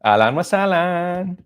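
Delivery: high, slow, camp greeting; most sissy. Shown as a demonstration. Showing how sissy Arabic could sound, drawn-out camp voice. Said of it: "I went the most sissy I could go".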